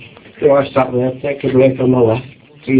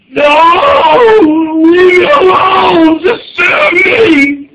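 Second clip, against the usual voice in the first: high, loud, slow wailing shout; desperate mock wail; 6 dB louder, much slower than usual. Cornered shopper begging the cashier, high slow wailing shout. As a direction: wail it out like you're begging for rescue. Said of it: "It's like".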